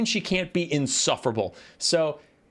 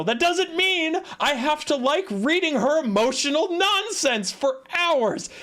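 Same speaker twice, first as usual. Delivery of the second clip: high-pitched exasperated ranting; mad; 13 semitones higher than usual. Real, not acted. Streamer rebuts the teenager defense, exasperated and high. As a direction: argue back indignantly, voice climbing. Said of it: "I'm mad how much she blames".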